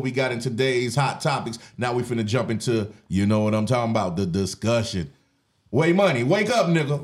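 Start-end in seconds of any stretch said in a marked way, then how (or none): 5.7-7.0 calling out. Real, not acted. Calling out to cohost.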